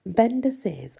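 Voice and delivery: plain reading voice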